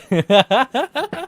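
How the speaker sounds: high, loud burst of laughter; real cackling laugh; loud cackle. Real, not acted. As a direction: crack up after your own parody. Cracks up after own parody.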